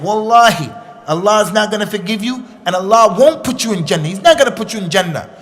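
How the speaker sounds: loud, fierce oath with wallahi; angry oath. Retold or imitated. Upset religious man swears at the sinner, loud and angry.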